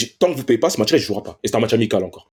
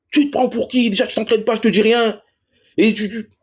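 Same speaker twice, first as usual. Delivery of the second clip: coach yelling; 10 semitones higher than usual. Retold or imitated. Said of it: "jamais m'avait crié dessus".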